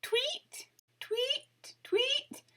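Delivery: high chirpy birdsong imitation; bird tweets. A narrator's reading. Bird landing in grass.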